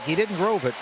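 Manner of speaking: ordinary talk, nothing marked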